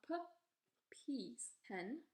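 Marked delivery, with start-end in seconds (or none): 0.1-0.2 "P" as short puh; isolated "puh" for "P"; letter sound demo. Shown as a demonstration. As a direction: say "P" as the first sound of peas. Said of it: "P makes a sound P".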